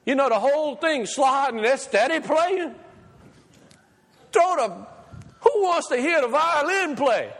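high, loud fired-up preaching; carried away, preach it to the rafters; excited preacher shouting Put on Christ; got excited. Real, not acted. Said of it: "I got a little excited".

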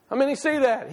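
usual speaking voice

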